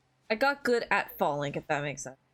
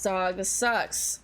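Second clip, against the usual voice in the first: normal talking voice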